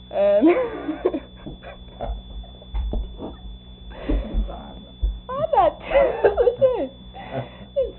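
laughing through the words. Real, not acted. Storyteller laughing at her own tale to the listener, giggling.

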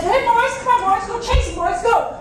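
loud, high, urgent shouting; calling the dogs. Acted. Frightened old man sending dogs after the critter, shouting. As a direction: send the hounds off at full holler. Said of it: "And he said".

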